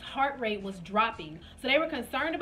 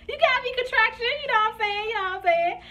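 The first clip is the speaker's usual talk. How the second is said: very high, strained mock composure; playful bravado; 11 semitones higher, 4 dB louder than usual. Laboring woman addressing her contraction, playful bravado. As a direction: talk back to the pain in a high squeal. Acts out bracing through a contraction.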